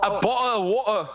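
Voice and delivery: mock English accent, bottle of water with dropped t's; hammed-up English accent. Shown as a demonstration. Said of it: "with an English accent".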